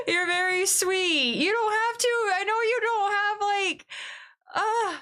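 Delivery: high, slow, squealing thanks; gushing over a kind gift, way up high; touched squeal. Real, not acted. Streamer thanking a generous viewer, squealing high with delight.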